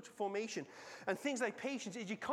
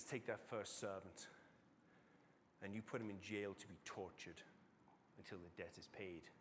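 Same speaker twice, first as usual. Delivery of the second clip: low, soft verdict from the king; grim quiet sentence; 12 semitones lower, 12 dB quieter than usual. Acted. King condenses the unmerciful servant, quiet and grim. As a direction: pronounce the sentence quietly and gravely.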